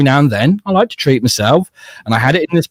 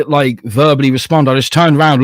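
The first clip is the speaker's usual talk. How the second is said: ordinary talk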